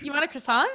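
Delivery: CROISSANT lifted upward at the end; rising American intonation. Shown as a demonstration. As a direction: let croissant climb like a question. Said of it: "us Americans go up instead of down".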